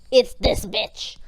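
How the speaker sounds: loud, drawn-out finger-pointing outburst; slowly and loudly call out the culprit; hammed-up dramatic accusation; mock accusation. Esther accusing Haman across the table.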